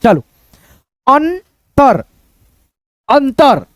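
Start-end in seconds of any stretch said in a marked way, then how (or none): 1.1-3.6 अंतर as an-tar, two falling beats; joined n-t. Shown as a demonstration. Teacher showing the word to students, अंतर read as अन्-तर. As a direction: hold अन् then तर in अंतर. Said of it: "अंतर पढ़ते हैं".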